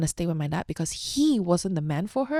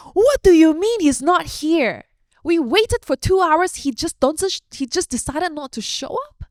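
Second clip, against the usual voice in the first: imitating upset mom; 10 semitones higher, 7 dB louder than usual. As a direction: voice mom's outrage at the no-show. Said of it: "She's like".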